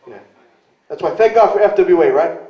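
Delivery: ordinary talk, nothing marked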